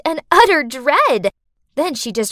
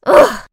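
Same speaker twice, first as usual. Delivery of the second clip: loud exasperated groan; frustrated ugh; 10 semitones higher, 9 dB louder than usual. Acted. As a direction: groan it out in disgust.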